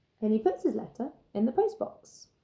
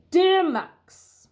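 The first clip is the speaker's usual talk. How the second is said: much louder, higher, booming greeting; booming T-Rex; 9 semitones higher, 10 dB louder than usual. Acted. T-Rex booming his reply to Max. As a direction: announce it like a giant.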